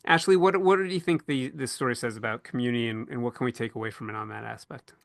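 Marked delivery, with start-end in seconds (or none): none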